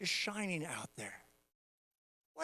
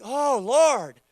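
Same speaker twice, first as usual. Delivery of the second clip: awed exclamation; 4 semitones higher, 10 dB louder than usual. Retold or imitated. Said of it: "that is like".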